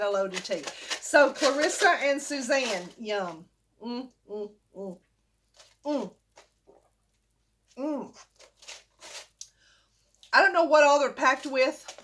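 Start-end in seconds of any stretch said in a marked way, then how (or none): 3.8-8.2 savoring hum. Real, not acted. Tasting the tea on camera.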